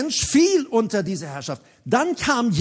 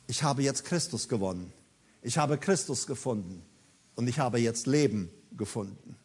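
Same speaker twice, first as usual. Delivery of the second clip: low, soft, measured declaration; quiet testimony; 8 semitones lower, 8 dB quieter than usual. Acted. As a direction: drop deep and hushed, phrase by phrase. Paul quietly declares what he found.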